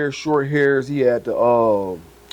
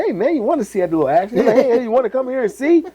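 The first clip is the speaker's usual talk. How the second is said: higher, faster voicing of the older breeder; lightly voiced older man, pitched up; imitating the breeder; 11 semitones higher, faster than usual. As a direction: play the breeder inviting him in, quick and high.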